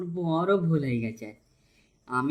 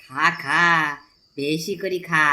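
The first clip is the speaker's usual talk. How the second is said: loud urging; 6 dB louder, slower than usual. Acted. Villain feeding stolen geese.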